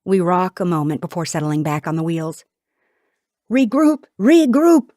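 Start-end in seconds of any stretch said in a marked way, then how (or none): 3.5-4.9 loud, high rallying cry; soldiers' yell. A narrator's reading. Soldiers rallying as the carriage is attacked, yelling. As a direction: yell the order across a battle. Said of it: "my soldiers yell".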